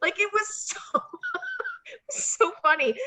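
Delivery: words cracking through laughter; laughing. Real, not acted. Laughing while telling.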